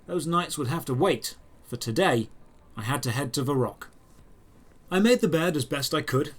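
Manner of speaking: plain reading voice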